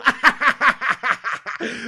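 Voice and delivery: loud, high burst of laughter; gloating cackle; mocking laugh. Real, not acted. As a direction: laugh right at the rival fan. Laughs at the rival fan.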